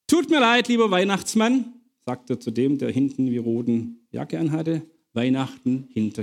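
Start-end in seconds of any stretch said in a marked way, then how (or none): 0.1-1.7 high-pitched, playful apology; mock apology. Officer apologises to the prisoner in red as Santa, mock apology. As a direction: plead teasingly in a raised pitch.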